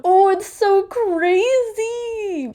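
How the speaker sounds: high, slow, gushing; excited. Real, not acted. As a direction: gush with excitement. Excited about the book.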